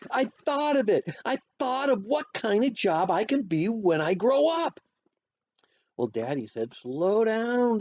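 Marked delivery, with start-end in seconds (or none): 0.1-4.8 put-on high, hollering excited kid; bursting with a big idea; excited announcement. A narrator's reading. Child announcing big idea to dad. 6.7-7.8 high, drawn-out DOWN; slow down order. A narrator's reading. Dad calming excited child.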